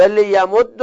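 لیمد slowly, doubled d ending in "u"; damma ending. Shown as a demonstration. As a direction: say لیمد as le-ya-mod-du. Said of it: "به خاطر تناسب حرکت". (لیمد با دال مشدد و ضمه)

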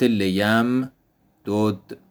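لیم دود split as "liyam-dud", two separate d sounds; لیم دود read unmerged, "li-yam-dud"; Arabic without merging. Shown as a demonstration. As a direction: keep both dals apart in لیم دود.

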